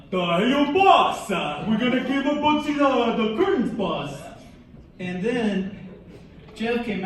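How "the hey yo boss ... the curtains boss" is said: put-on eager gangster henchman with a New York accent; hammed-up New York mobster flunky; New York gangster sidekick. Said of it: "like, old-time gangsters, from, like, New York".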